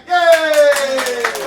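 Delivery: excited cheer. Real, not acted. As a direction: whoop to kick off the game. Host cheering to start game.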